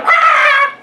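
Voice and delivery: high loud imitated shriek; mock scream. Parent drops something, imitating the scream. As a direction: let out a startled yelp. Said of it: "you say".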